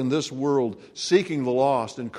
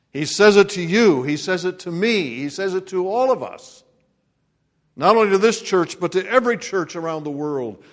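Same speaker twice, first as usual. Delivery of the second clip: rising, booming sermon proclamation; preaching; 5 semitones higher, 4 dB louder than usual. Acted. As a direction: proclaim it to the congregation. Preaching at full force.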